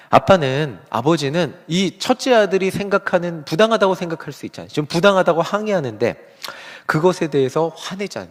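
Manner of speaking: ordinary talk, nothing marked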